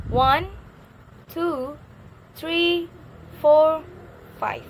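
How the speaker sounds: high, level numbers with pauses, "five" dropping; counting intonation. Shown as a demonstration. Teacher repeating the counting model, high steps and falling "five". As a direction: keep "one" to "four" up, let "five" fall. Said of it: "in the word five".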